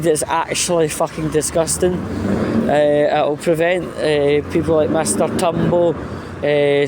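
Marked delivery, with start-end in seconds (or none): none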